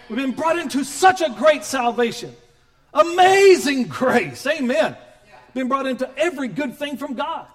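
loud, rising sermon delivery; full-force preaching. Acted. Pastor preaching loud to the congregation. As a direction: preach it out with fire.

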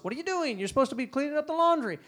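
sharp, fast scolding mom; annoyed mom. Retold or imitated. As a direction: snap at the kid like an irritated mother.

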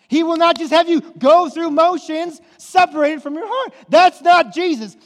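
loud, high, fired-up preaching; full-force preaching. Acted. Preacher fired up, driving home that Jesus disrupts life. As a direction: preach it out at the congregation.

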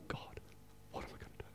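panicked whispered prayer; desperate whisper. Retold or imitated. Locked out of the car, pleading whisper to God. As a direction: breathe it out in quiet dread.

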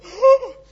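loud high gasp through the blowhole; huge gasp for air. Retold or imitated. Jonah in the whale coming up for air, loud gasp. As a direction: gulp air like surfacing from a whale. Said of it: "I'd lean up and go".

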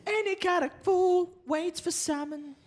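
high, slow mock falsetto. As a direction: keep the high silly voice.

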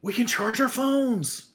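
thrilled discovery, stretching the words; excited realization. Acted. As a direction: marvel at it, pitch climbing high. Sudden delight at finding electricity.

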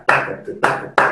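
unvoiced ta ta ta, marking the beat; whispered rhythm syllables. Shown as a demonstration. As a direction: breathe the rhythm out without voice. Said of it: "let me count".

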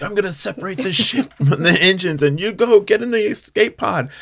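loud, high, urgent acted order; hammed-up hero taking charge; mock-heroic command. Paladin sending covid to escape pod, hammed-up urgent command.

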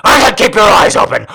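loud, fast, furious command; angry shout. Acted. Lover snaps at her to keep eyes open, loud angry shout. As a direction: bark it at her full force.

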